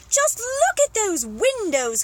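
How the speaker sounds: high, loud, slow exclamation; excited cry. Acted. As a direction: cry out in wonder at the sight. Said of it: "cried the monkey".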